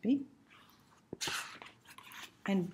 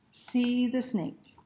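ordinary delivery

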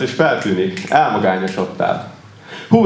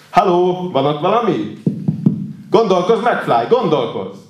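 high, taunting call; mocking film quote; 8 semitones higher than usual. Bully telling McFly to think, mocking taunt. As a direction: taunt a slow-witted person, starting high.

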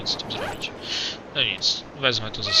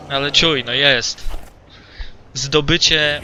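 hyped up; 2 semitones higher, 5 dB louder than usual. Real, not acted. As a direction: psych yourself up before the hard part. Gracz nakręca się przed trudnym fragmentem. (głośno i z nakręceniem)

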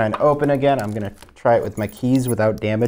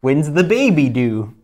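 tactless quip; 4 semitones higher, 4 dB louder, slower than usual. Retold or imitated. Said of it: "It's got no tact".

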